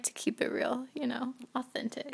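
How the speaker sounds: unvoiced confiding whisper. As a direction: whisper it to the listeners. Whispered aside to listeners.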